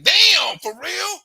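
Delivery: loud high disbelief; shocked. Retold or imitated. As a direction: gasp it out.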